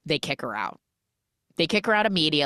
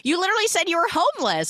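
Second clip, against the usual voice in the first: incredulous; much faster than usual. Retold or imitated. Man pointing out the obvious.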